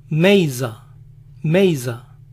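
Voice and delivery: Mesa with an ey sound, meiza, American accent. Shown as a demonstration. To the viewers, how Arizonans say Mesa, American accent. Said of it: "ellos lo pronuncian".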